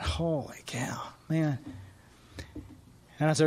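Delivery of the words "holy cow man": hushed, breathless holy cow; stunned whisper. Retold or imitated. Sponsor hearing the confession, whispering in shock. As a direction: barely whisper it in awe.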